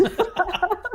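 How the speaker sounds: high burst of laughter at her own joke. Real, not acted. Host laughs at many hats.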